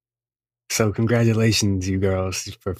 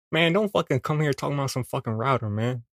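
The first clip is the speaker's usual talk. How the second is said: scolding second voice; 4 semitones higher than usual. Acted.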